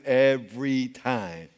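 low, slow, steady reply; calm coach reply. Retold or imitated. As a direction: settle it calmly and firmly. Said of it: "He says".

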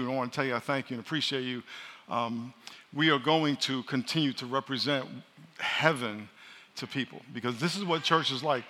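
normal talking voice

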